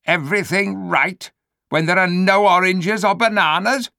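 indignant ape. Acted. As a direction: scoff at the very idea. Ape outraged at the idea.

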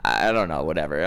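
ordinary delivery

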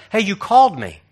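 high, pushy reply; insistent call. Acted. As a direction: burst in and insist loudly. Samuel runs to Eli, acted.

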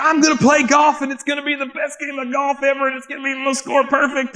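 high, insistent revival preacher impression; fixated evangelist. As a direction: mimic an evangelist carried away with his dream. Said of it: "he's just fixated on this".

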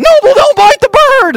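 high, loud, slow yell at the dog; retold yell. Wife yelling at the puppy Noble, high mock shriek. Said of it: "she's yelling".

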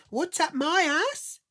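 MY ASS thrown back high, loud and drawn out; indignant echo. Retold or imitated. Wife echoing her husband's question, in high slow indignation. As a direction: shriek the question back, slowly, outraged.

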